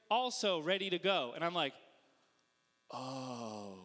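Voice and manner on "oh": low, soft, drawn-out "oh"; dawning dread. Acted. Onlooker seeing more people climb out, uneasy. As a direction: let the worry sink in on one low note. Onlooker realises the fight is real.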